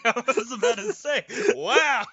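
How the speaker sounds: amused surprise; laughing surprise. Real, not acted.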